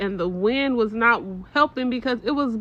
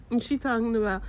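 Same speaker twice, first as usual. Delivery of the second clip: normal talking voice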